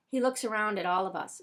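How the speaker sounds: ordinary delivery, nothing marked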